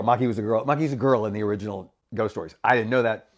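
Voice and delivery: ordinary talk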